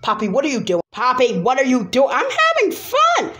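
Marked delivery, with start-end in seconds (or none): 2.1-3.3 very high, slow gleeful squeal; squealing fun. Acted. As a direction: shriek with joy while whizzing past. Character zip-lining happily.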